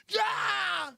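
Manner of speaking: high, drawn-out mock wail; hammed-up high yell; angry scream. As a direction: cry out in exasperated rage. Mad worker screams after the order.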